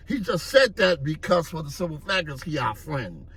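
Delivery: usual speaking voice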